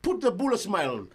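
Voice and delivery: loud, high, slow bossy call; hammed-up boss scolding the workers. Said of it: "ngam betul ni tu boss".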